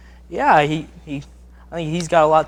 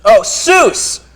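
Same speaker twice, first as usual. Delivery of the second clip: shouted exclamation; 7 semitones higher, 8 dB louder than usual. Acted. Shouting the Seuss line.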